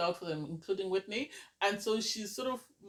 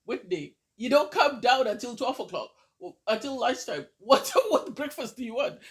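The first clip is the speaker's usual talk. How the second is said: louder, higher teasing call-out with a laugh; mock exasperation; 5 semitones higher, 7 dB louder than usual. Imitating Maddie teasing Whitney about breakfast, mock exasperated and louder. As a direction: ham up a playful telling-off.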